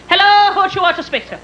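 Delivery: put-on high Irish-accented phone greeting; Irish brogue. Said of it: "He's got a thick brogue".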